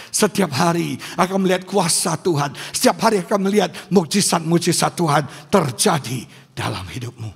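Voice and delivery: punched, emphatic declaration; forceful preaching. Real, not acted. Pastor promises congregation daily miracles, preaching forcefully. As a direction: proclaim each promise with force.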